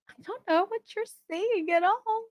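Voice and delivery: high, confused, lost in the talk; can't follow a word; baffled high voice. Retold or imitated. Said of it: "and I go".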